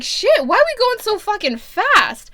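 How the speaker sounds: high, loud exclamation of disbelief; shocked reaction. Retold or imitated. Reader reacting to the intimate scene, shocked outburst. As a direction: blurt it out high and alarmed.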